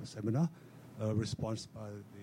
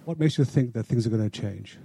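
much louder, clearly projected; raised voice repeating the question; repeated louder; 14 dB louder than usual. Real, not acted. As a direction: say it again so the speaker can hear. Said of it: "I couldn't hear what you were saying".

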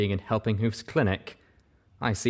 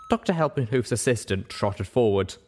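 plain reading voice

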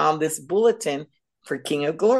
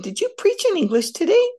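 much higher and louder, astonished; amazed question; 13 semitones higher, 4 dB louder than usual. Retold or imitated. Wife asking her husband after the service, astonished. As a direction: ask it in disbelief, voice jumping up high.

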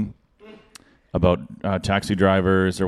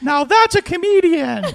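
put-on a drunk fan in the mosh pit gushing; mocking a dim festival-goer; dopey crowd member; 4 dB louder than usual. Drunk festival crowd cheering him.

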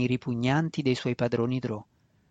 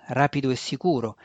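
plain reading voice

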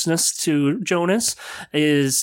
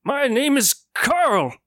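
high lightly voiced old man; high old shopkeeper introducing himself; 8 semitones higher than usual. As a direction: announce it with confidence.

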